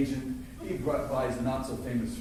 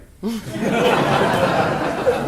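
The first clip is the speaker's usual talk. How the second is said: big burst of real laughter; loud laugh; 8 semitones higher, 12 dB louder than usual. Real, not acted. Actor laughing at his own Burton story, loud laughter. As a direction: let the laugh roll out loud.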